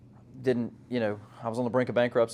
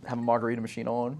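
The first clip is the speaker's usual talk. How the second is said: ordinary talk, nothing marked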